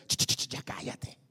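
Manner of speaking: whispered shushing; hushing. Acted. Bystander silences someone seeking God, hushing. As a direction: shush someone under your breath. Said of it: "alguien alrededor que le va a decir".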